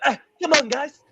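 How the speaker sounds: high, put-on French team talk in a mock French accent. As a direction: mimic the French captain rallying the team, French accent. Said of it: "trying to give team talks in his French accent".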